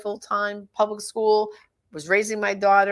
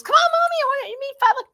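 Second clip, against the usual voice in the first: put-on little girl; 5 dB louder than usual. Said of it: "And she goes".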